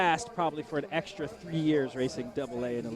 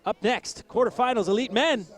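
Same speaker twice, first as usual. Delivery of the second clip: announcer call; 7 semitones higher, 7 dB louder than usual. Acted. Announcer calling next race.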